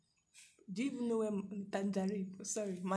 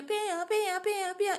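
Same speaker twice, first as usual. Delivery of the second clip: higher, louder siren wail of pia pia; imitated police siren; 10 semitones higher, 6 dB louder, slower than usual. Mocking the police van on the road, siren imitation. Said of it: "That is their siren now".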